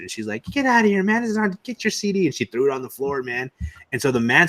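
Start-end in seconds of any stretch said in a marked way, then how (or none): 0.5-2.3 high, sassy brush-off; a girl with attitude telling the seller off, pitched up. Retold or imitated. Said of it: "she had some good attitude".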